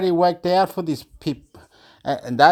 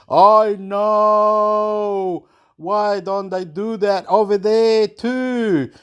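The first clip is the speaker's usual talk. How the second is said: mother's drawn-out wail, raised pitch; hammed-up plaintive protest; mock wailing; 5 semitones higher, much slower than usual. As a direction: imitate an upset mother wailing slowly. Imitates mother's screaming protest.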